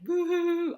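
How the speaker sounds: high, loud cartoon sob, hammed-up; mock wail to show she wasn't sobbing; mock boo-hoo. Describing crying in the shower, mocking a loud sob. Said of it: "but I wasn't like".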